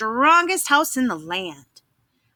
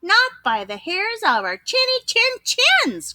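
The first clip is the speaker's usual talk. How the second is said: three pigs shouting back at the wolf; pigs crying out; 11 semitones higher than usual. Acted. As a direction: squeal the refusal high. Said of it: "cried the three little pigs".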